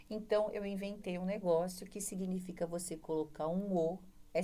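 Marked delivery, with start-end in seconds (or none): none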